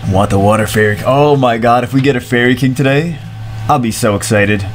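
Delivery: ordinary delivery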